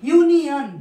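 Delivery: loud "ইউনিয়ন" opening on "yoo"; initial U as "yoo". Shown as a demonstration. Teacher giving class another example, loud "ইউনিয়ন" with initial "yoo". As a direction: call out "ইউনিয়ন" with a clear "yoo", then fall. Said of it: "ইউ এর মতো হচ্ছে".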